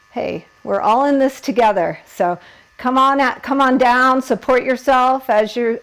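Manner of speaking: ordinary delivery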